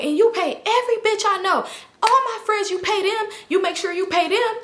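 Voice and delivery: high-pitched mock tirade; high, indignant impression of a friend; retold accusation.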